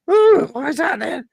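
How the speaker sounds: tiny high squeaky mongoose voice; Jeff's little voice. Acted. Talking mongoose greets the investigator in a tiny high voice. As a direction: pitch it way up like a small talking animal. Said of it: "this little voice".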